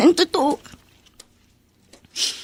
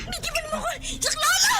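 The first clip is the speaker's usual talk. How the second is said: much higher, crying out in fear; terrified scream; 14 semitones higher, 9 dB quieter than usual. Acted. A girl grabbed by a stranger screams for help in terror. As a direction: scream to be let go.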